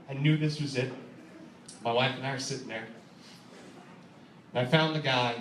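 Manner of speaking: ordinary delivery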